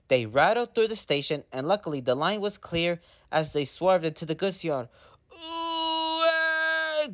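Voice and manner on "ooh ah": high, strained crying groan; engine groaning. Acted. Thomas skidding on the rails, groaning high and strained. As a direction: groan as the brakes skid. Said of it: "groaned Thomas".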